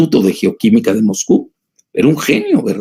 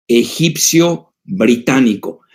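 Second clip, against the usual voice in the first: usual speaking voice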